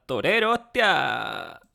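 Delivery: loud playful fake Castilian; mock Spanish accent. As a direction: shout it out like a cartoon Spaniard. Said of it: "yours would be".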